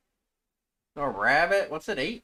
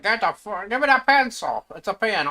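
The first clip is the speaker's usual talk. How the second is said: dog's shouted demand, louder and higher; voicing the dog; 7 semitones higher, 8 dB louder than usual. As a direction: snap at him as the grabby dog. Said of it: "That dog's like".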